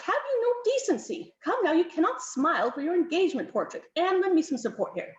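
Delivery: high, scandalised scolding; admonishing. A narrator's reading. Countess scolds Darcy for smiling in his portrait, admonishing. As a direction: start very high in outraged propriety. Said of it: "the Countess admonished".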